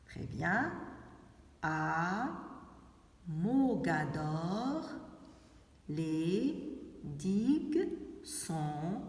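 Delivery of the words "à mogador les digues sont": Mogador, les digues sont désertes spoken very slowly; a slow, careful reading for the class; slow dictation. Shown as a demonstration. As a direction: stretch every word of the sentence for young pupils. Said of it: "qui peut me rappeler de cette phrase".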